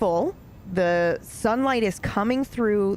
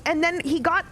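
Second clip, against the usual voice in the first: usual speaking voice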